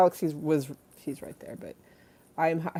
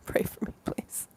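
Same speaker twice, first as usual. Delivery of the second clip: whispered plea; 4 dB quieter than usual. Real, not acted. Whispered plea after whisper run.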